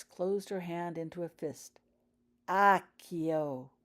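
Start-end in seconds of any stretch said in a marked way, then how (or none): 2.5-3.7 drawn-out, louder summoning word; slow spell. A narrator's reading. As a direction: stretch the spell out. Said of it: "she said slowly".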